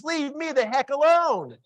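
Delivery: high, slow, exaggerated dismissal; hammed-up, drawn-out "go away"; mock brush-off. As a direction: spell out a stranger's keep-away message. Voices adult's unspoken message.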